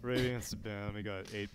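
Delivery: quiet, low-key boring commentary; deliberately dull play-by-play; boring caster. Pretend. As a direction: cast it as dully as possible. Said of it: "has to be as boring as possible".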